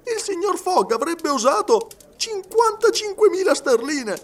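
very high, loud, incredulous question; astonished disbelief. A narrator's reading. Servant asking about his master's wager, astonished. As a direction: carry the shock through at high pitch.

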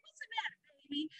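high, soft motherly voice; tender comfort. Retold or imitated. Kind agent comforting the sobbing traveler, high soft voice. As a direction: soothe her like a crying child.

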